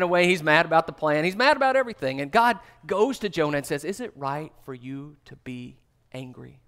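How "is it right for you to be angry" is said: quiet, lower, soft fatherly question; gentle question. Acted. God gently asking angry Jonah, quiet and low. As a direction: ask it softly, without blame.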